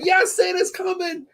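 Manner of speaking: high, slow thrilled cry; excited kid voice. Retold or imitated. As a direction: gush it like an overjoyed child. Retold kid on Christmas Eve.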